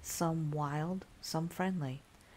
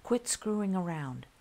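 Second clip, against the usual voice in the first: angry; 5 semitones higher, 4 dB louder than usual. A narrator's reading. Angry at hidden prankster.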